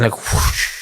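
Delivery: breathy whoosh of a gust of wind; whoosh of cold air. Retold or imitated. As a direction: blow it out like a blast of cold air. Door opens, cold air rushes.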